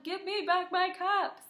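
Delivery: high, put-on peddler voice; kid-story character voice; peddler's demand. Shown as a demonstration. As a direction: voice the peddler demanding his caps. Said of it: "He's trying to tell them".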